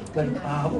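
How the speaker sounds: ordinary talk, nothing marked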